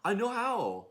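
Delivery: put-on high, loud child boasting; eager child calling out; little kid voice. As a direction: play a proud little kid shouting to mom.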